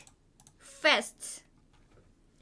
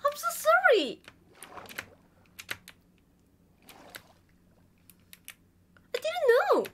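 loud pleading apology; 10 semitones higher, 3 dB louder than usual. Real, not acted. Apologizing loudly.

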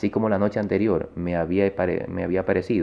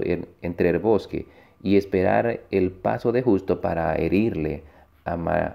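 usual speaking voice

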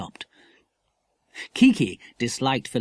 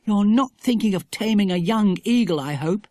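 alarmed; 7 semitones higher, 3 dB louder than usual. A narrator's reading. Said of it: "said Dinah in alarm".

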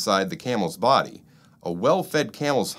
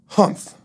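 loud scornful humph; grumpy humph; 7 dB louder than usual. A narrator's reading. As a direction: snort it out like a sulky camel. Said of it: "the Camel said".

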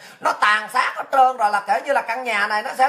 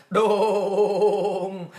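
long drawn-out low "boom"; imitated gun boom; 3 semitones lower than usual. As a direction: draw out a booming gunshot noise. Imitates the gunshot sound.

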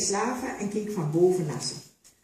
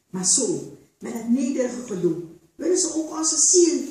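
rising to much higher and louder anger; building outrage; 9 semitones higher, 6 dB louder than usual. A narrator's reading. Tutuba rails against the masters, building to fury. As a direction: let the anger swell.

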